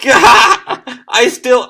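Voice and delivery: high, loud voice breaking into laughter; giggling creepy mortician; laughing Undertaker. Retold or imitated. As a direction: laugh through the words as Undertaker.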